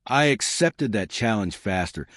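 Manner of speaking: ordinary delivery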